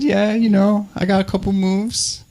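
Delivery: high-pitched, coy bragging; mock-modest boast. Comedian to date who guessed he's amazing in bed, mock-modest boast. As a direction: lift the voice high, playing it coy.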